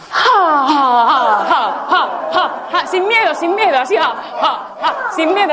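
high little rhythmic laugh of separate "ja"s. Shown as a demonstration. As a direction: let out a light, high "ja, ja" laugh. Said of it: "esta vocecilla así". (risa de vocecilla, ja, ja)

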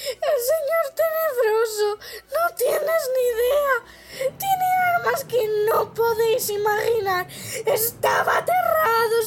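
high sobbing plea; crying. A narrator's reading. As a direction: beg through tears, voice high. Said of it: "Pettigrew rompió a llorar".

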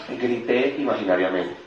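ordinary delivery

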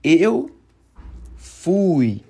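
Eu and fui each said separately, loud and high; drilled first person. Shown as a demonstration. Teacher drilling Spanish speakers on the past tense, loud model. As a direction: pause between Eu and fui, both punched hard. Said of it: "vai dizer como?".

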